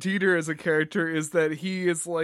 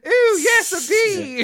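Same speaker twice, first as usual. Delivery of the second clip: put-on high, giddy old lady squeal; 11 semitones higher, 5 dB louder, much slower than usual. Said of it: "giddy old lady".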